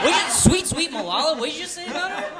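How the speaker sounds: high, loud, scandalized disbelief; mock outrage. Friends defend Malala to her roommate, in mock outrage.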